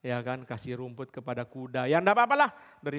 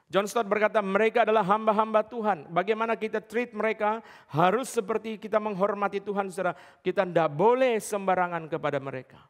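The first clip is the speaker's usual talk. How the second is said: higher, louder pulpit proclamation; preaching at full force; 7 semitones higher, 5 dB louder than usual. Acted. Preacher drives home that service has standards, fervent. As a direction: hammer each standard home to the congregation.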